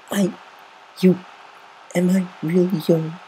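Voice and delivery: high, halting filly stammer. A narrator's reading. Scootaloo stammering to Rainbow Dash, high and halting. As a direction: stumble over the words in shock. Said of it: "Scootaloo stammered".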